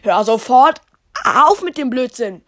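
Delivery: loud, sharp telling-off; angry scolding. Acted. Guard to child playing in skeleton, angry shout. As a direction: burst out loud to stop the nonsense.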